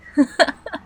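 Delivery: high, loud mocking cackle; villain's laugh. Computer villain mocks captive's lost wife, cruel cackle.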